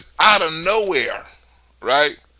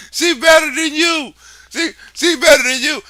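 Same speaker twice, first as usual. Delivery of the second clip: mock taunt; 6 semitones higher than usual.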